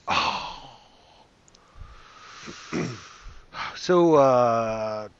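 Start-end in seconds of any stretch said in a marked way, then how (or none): 0.1-0.7 long, airy "oh" sigh; a whole crowd's sigh, acted; sigh of relief. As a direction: let the breath out in a voiceless sigh. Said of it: "let out a sigh of".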